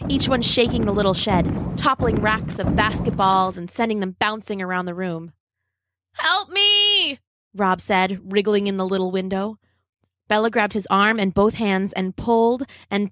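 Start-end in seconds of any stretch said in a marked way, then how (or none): 6.1-7.2 high, drawn-out plea; acted alarm, stretched at the end; panicked cry for help. A narrator's reading. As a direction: call for rescue with pitch way up. Stuck in window, T-Rex nearing.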